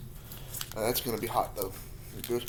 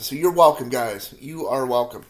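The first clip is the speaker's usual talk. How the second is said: loud proud boast; 10 dB louder than usual. Real, not acted.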